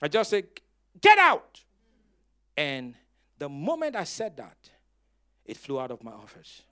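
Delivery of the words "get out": sharp, high, loud command; loud rebuking yell at a fly; commanding shout. Retold or imitated. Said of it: "because I shouted at the fly".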